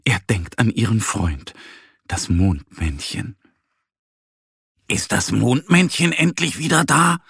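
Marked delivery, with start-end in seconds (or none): 4.9-7.2 higher, eager question; hoping his friend is back; hopeful little bear. A narrator's reading. Little bear asking the squirrel, hopeful and eager. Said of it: "fragt er".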